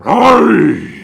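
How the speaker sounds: loud, drawn-out booming cry; booming ghostly voice. Acted. Summoned spirit voice rises from the illusion, booming. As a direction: boom it out like a risen warrior. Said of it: "you hear a voice come booming".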